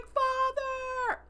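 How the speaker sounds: high, drawn-out wail after someone leaving; mock anguished call. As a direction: imitate an abandoned son crying out. Abandoned son calls after ship.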